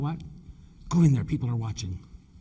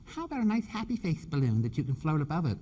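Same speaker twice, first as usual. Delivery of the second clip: naive staffer; 4 semitones higher, 4 dB quieter than usual. Retold or imitated. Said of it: "She said".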